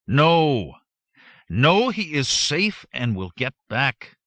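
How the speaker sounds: loud, slow defiant shout. A narrator's reading. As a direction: shout it out to build morale. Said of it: "I shouted out loud".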